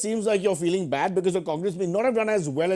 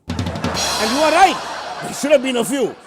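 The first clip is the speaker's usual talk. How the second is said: loud punchline; 5 semitones higher, 4 dB louder, slower than usual. Acted.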